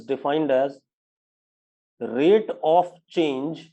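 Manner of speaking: very slow, word-by-word dictation; spaced-out teacher's dictation, each phrase held apart. Shown as a demonstration. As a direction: read it out slowly for students to write down. Dictates a definition to students.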